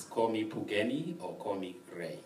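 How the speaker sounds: normal talking voice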